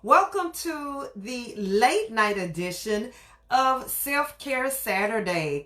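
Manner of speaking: show host intro. Acted. Host's drawn-out show intro.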